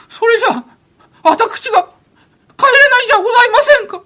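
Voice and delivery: a sheltered heir about to burst into tears; young master crying. Acted. Young master whimpers that he cannot go home, near tears. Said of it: "泣いちゃった。".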